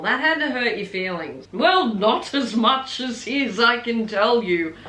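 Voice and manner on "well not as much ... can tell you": ordinary talk, nothing marked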